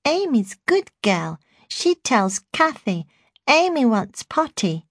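put-on high, slow toddler babble; baby voice. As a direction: talk like a small baby girl. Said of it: "chattering in her baby voice".

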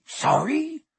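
high, incredulous bark; indignant bark. A narrator's reading. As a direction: bark it back as a rising question. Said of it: "barked Hagrid".

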